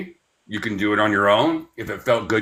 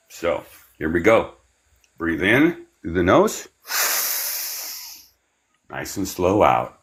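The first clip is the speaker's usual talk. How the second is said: guided breathing; 5 semitones lower than usual. Acted. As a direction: ease them through each breath, gently. Leads a guided breathing exercise.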